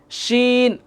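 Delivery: drawn-out hushing শিন; inner-mouth shin. Shown as a demonstration. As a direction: say শিন with sh, tongue off the teeth. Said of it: "একটু ভিতর থেকে উচ্চারণ হবে".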